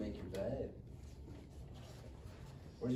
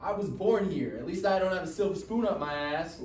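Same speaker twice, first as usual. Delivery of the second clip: higher, louder angry comeback; angry retort; 7 semitones higher, 7 dB louder than usual. Acted. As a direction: fire back furious during the scuffle. Boys fighting, Cesar angry.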